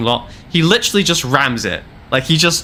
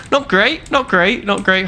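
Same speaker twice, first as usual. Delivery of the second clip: higher "not great" easing down each time; mock squeak; 7 semitones higher than usual. Vlogger mocks the poo river, squeaky and higher. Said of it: "comic repeated verdict".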